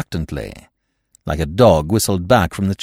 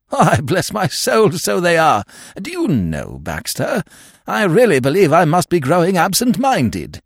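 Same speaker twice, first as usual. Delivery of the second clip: high, cheerful exclamation; delighted relief; 8 semitones higher than usual. Acted. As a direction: beam with relief.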